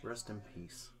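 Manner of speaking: low, solemn undertaker voice; hushed funeral director. Acted. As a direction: intone it like a somber undertaker. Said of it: "That's my Undertaker impression".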